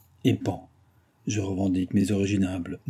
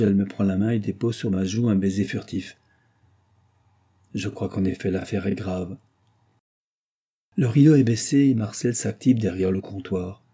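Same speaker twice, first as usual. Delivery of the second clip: plain reading voice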